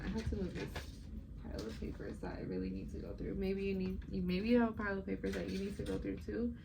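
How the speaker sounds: usual speaking voice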